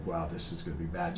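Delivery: low, soft inner voice; quiet dread. Retold or imitated. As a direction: retell a sinking thought under your voice. Dreading inner thought, retold.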